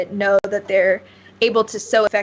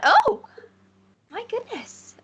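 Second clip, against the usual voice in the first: shopkeeper's startled exclamation; 13 semitones higher than usual. Acted. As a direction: exclaim high, then trail into softness. GM voices someone heard across shop.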